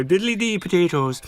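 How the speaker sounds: cheesy stage Irish lilt tacked onto the end; mock Irish accent. Mocking the Irish accent to the panel, hammed-up stage Irish. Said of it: "at the end go".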